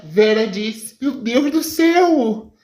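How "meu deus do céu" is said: hammed-up horror with a nervous laugh; shocked. Mãe ouve que o sobrinho foi sequestrado, chocada e aflita.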